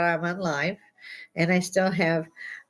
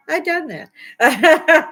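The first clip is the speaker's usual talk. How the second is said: slow, high, louder through a laugh; laughing admission; 10 semitones higher, 7 dB louder, much slower than usual. Real, not acted. Admitting she climbed the shelves, laughing. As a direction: confess it laughing.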